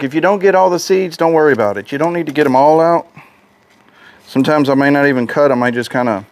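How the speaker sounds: usual speaking voice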